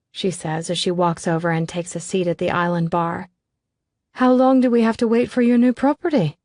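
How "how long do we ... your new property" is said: higher, louder, chirpy question; bright curious friend; perky Davina. A narrator's reading. Davina asking Declan about the property, chirpy.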